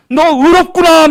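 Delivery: a voice of God declaring you righteous; proclaimed blessing. Acted. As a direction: announce it like a verdict of grace.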